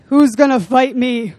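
loud taunting Goliath voice. Acted. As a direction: bellow it like a giant calling out the army. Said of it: "That's how I hear Goliath's voice".

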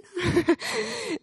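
a bright burst of real laughter. Real, not acted. Laughing at her husband's teasing, real laugh. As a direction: laugh it off warmly.

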